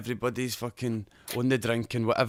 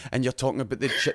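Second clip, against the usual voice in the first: usual speaking voice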